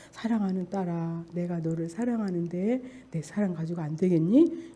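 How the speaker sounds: quiet, low reread of the words; soft reading of the note. Retold or imitated. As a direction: read the note back gently. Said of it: "근데 내가 보니까". (메모를 조용히 읽듯)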